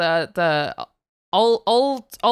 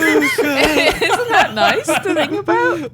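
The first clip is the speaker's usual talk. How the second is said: laughing; 8 semitones higher, 4 dB louder, much slower than usual. Real, not acted. Laughing at the performance.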